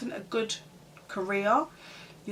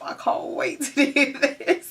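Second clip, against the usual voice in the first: higher, louder, breaking into laughter; mock youthful eagerness; 5 semitones higher, 6 dB louder than usual. Young friends wishing they were grown up, laughing excited gush. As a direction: gush it impatiently through a laugh.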